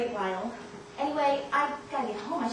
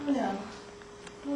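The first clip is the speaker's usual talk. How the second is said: usual speaking voice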